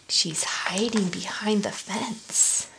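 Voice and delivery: secretive whispered aside. Real, not acted. Aside to kids during reading.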